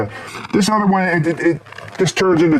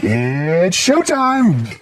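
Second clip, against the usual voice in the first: very slow, drawn-out tease; sultry showtime line; 5 semitones higher, much slower than usual. Retold or imitated. Woman inside announcing her show, very slow and teasing. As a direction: stretch it out like a stripper's tease. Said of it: "that bitch inside there going".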